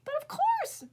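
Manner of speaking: very high chirpy bird cry; chirped. Acted. Meadowlark happily answering Little Buffalo, high chirp. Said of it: "Meadowlark chirped".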